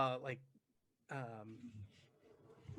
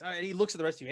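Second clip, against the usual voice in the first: usual speaking voice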